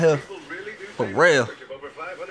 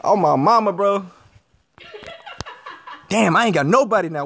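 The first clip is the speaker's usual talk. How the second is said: normal talking voice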